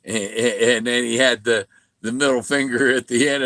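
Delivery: ordinary talk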